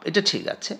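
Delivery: ordinary talk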